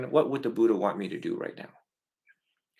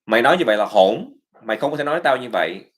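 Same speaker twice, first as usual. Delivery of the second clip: fast, loud scolding in Vietnamese; scolding parent; 7 dB louder, much faster than usual. Retold or imitated. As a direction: snap at the kid like a harsh judge. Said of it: "to be a judge in those moments to say".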